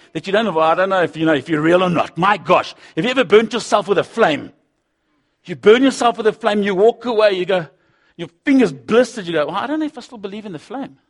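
loud, mocking pulpit argument; preaching at full force. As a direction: throw the doubter's words back at them. Argues with doubters, preaching.

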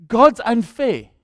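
God's unfair dragged out slow and loud; slow loud complaint; drawn-out whine. Retold or imitated. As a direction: stretch God's unfair into a loud sulky moan. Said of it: "repeated, drawn out".

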